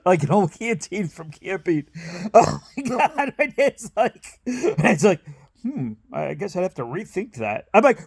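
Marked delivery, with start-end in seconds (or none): 5.6-7.7 sheepish dad. Retold or imitated. Dad sheepishly reconsidering, voiced.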